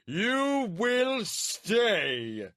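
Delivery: very slow, high, loud command; shouted order. A narrator's reading. Warlord shouting at the guard, commanding. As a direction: pin the guard in place. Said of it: "The warlord shouted".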